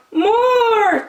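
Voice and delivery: high, loud cheer; cheering crowd. Acted. The audience cheers Bear on, loud and high. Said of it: "cheered the audience".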